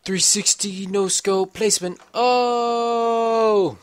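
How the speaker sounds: loud, high trick-shot call ending in a long whoop; mock hype. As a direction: hype it up into a drawn-out shout. Hyped mock trick-shot boast.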